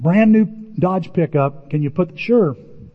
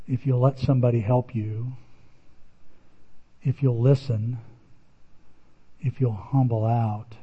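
gentle voice of God; 4 semitones lower, 6 dB quieter than usual. Retold or imitated. The Lord answering Tim in the van, soft and reassuring. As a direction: comfort him quietly, no hurry. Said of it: "I'm having this conversation".

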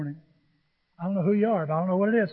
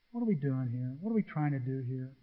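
quiet doubt; 6 semitones lower, 8 dB quieter than usual. Retold or imitated. Doubting thoughts.

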